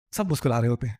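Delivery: ordinary delivery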